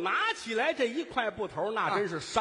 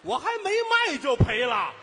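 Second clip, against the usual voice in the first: higher, louder indignant outburst; exasperated protest; 6 semitones higher, 5 dB louder than usual. Acted. Vendor scolds helper for shouting too early, exasperated protest. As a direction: complain loudly at the blundering helper.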